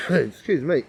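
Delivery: ordinary talk, nothing marked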